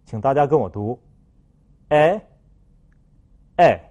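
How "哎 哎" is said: 哎 as a pure French è, mouth spread sideways, tongue tip down; French open e. Shown as a demonstration. Teacher models the second vowel, 哎 as spread French è. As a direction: say 哎 less open than a, wider side to side. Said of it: "口腔左右打开的幅度比啊更加的大".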